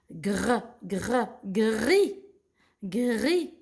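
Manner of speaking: teacher's slow blending, no S; silent S. Shown as a demonstration. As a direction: build "gris" from a rolled-out "gr". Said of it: "Le S, c'est une lettre muette".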